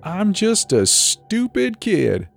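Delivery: slow, high mock innocence; singsong self-mockery for the crowd; laughing taunt. A narrator's reading. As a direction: drag it out high, playing the silly kid. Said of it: "he laughed".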